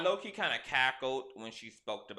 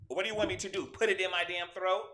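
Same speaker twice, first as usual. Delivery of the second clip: exasperated outburst; 8 semitones higher, 6 dB louder than usual. Real, not acted. Frustrated rant about the mic.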